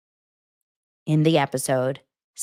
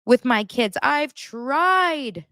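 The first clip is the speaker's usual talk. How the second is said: higher, louder whiny mock of Cody; 6 semitones higher, 3 dB louder, much slower than usual. Vlogger imitating Cody's self-pity, higher whiny mock. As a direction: whine it like a martyr. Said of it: "He says".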